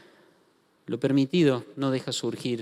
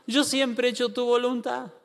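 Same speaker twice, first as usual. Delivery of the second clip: mock tearful plea; 8 semitones higher than usual. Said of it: "Se larga a llorar y dice".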